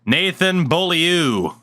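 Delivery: Beaulieu as "BOH-lee-oo", slow and high; drawn-out "Nathan Beaulieu" with an anglicized boh-lee-oo; American mispronunciation. Shown as a demonstration. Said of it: "say it like, uh, Americans used to say it".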